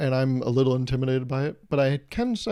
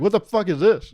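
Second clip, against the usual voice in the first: loud and higher, furious demand; angry yell; 7 semitones higher, 5 dB louder than usual. Retold or imitated. Partner bursts in hearing the ASMR, angry yell.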